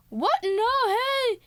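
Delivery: high squeaky acted alarm; hammed-up startled objection; mock outraged protest. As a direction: squeal in pretend disbelief. Voicing people losing their car.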